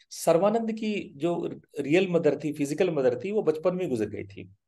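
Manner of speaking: ordinary talk, nothing marked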